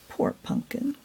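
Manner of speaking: breathy hushed pity; pitying whisper. A narrator's reading. Narrator sighing over lonely Pumpkin, whispered. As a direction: whisper it with sympathy.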